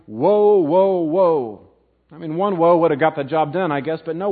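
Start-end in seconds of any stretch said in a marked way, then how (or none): 0.1-1.7 WOE cried out high and loud three times; emphatic warning. Retold or imitated. Preacher quoting the warning of Rome's doom, emphatic. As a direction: hit the first WOE hardest and keep the force up. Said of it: "emphasized with the warning".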